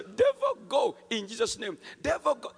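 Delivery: very high, loud rebuke; emphatic shouted command. Retold or imitated. Believer pushes harder against the demon, emphatic shout. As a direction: hammer the command harder each time. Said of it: "The more I emphasized that".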